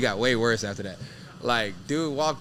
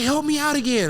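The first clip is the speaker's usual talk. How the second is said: high, louder mock plea of the driver; pleading driver; 12 semitones higher, 5 dB louder than usual. Truck driver begging him for help again, high mock plea. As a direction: beg for help in a pitched-up voice. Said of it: "He's like".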